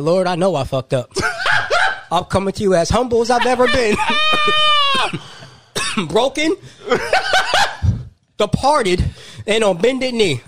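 hammed-up pleading prayer, half laughing; mock prayer. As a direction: beg the Lord like a broken man, for laughs.

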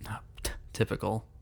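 unvoiced teasing whisper. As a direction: breathe it as a whisper. Whispered reply.

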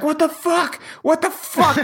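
high, panicked cries; retold panic. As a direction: replay the panic on the table.

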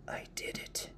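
put-on whispery old woman's rasp; soft raspy voice. Old woman confessing to the woodcutter, soft and raspy. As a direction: rasp it out softly, almost without voice. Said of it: "soft, raspy voice".

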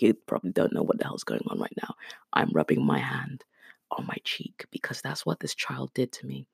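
soft, unvoiced whisper; confiding hushed whisper; whispered aside. Real, not acted. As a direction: lean in and whisper the explanation.

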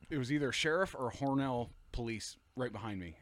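plain reading voice

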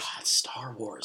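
slow whispered groan; whispering. Real, not acted. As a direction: breathe it out slowly as a whisper. Answers the Star Wars pun.

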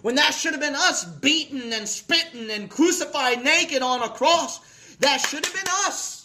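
high, driving pulpit exhortation; impassioned preaching. Real, not acted. Preacher asking how we can whine about Calvary, impassioned.

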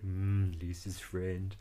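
low, soft impression of a cartoon dad; imitated Homer. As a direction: let it drop low and quiet. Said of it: "He turns around and is like".

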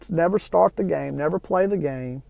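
ordinary talk, nothing marked

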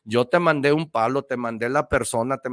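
a hint of a patient God scolding; God's voice. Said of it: "le dijo Dios".

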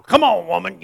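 lightly voiced caveman command; a hint of a bossy caveman husband; caveman style. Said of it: "The caveman style".

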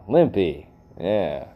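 ordinary talk

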